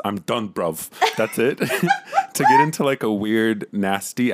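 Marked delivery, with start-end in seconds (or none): 0.0-1.6 Manchester accent. Shown as a demonstration.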